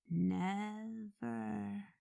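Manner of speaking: drawn out one syllable at a time, hushed; "Never" spoken slowly, syllable by syllable, almost to himself. A narrator's reading. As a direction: let each syllable of "Never" fall slowly and softly. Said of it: "pronouncing the word slowly, syllable by syllable".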